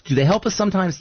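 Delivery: normal talking voice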